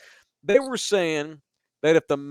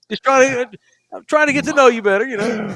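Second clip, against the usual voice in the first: excited; 9 semitones higher, 6 dB louder than usual. Real, not acted. Teasing a guest about texting.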